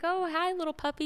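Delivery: high, sweet talking-to-a-puppy voice; playful greeting. Retold or imitated. Mother to daughter playing puppy, playful high greeting.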